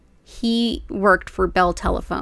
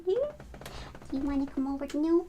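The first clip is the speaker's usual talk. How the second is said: pet talk; 6 semitones higher, 12 dB quieter, faster than usual. Real, not acted. Coaxing her cats Hattie and Bubba over, very high soft baby talk. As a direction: coax the kitty over in a high sing-song.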